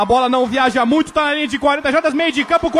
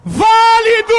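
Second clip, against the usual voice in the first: high loud shout; excited call; 9 semitones higher, 5 dB louder than usual. Real, not acted. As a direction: bark the call out. Kick is good.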